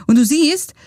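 ordinary talk, nothing marked

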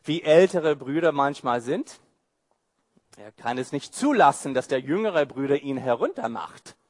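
usual speaking voice

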